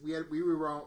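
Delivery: loud excited blurt; excited burst. Real, not acted. Excited fan telling friends, loud burst.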